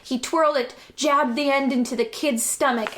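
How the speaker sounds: ordinary talk, nothing marked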